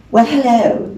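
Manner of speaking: a light suave Cary Grant hello; a hint of smooth film-star charm; Cary Grant greeting. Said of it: "You know how he talks".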